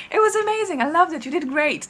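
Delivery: high, playful mock indignation; kidding. Fan showing she was only joking with him, kidding. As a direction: pitch it high without pushing the volume. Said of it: "That's, like, how I said it".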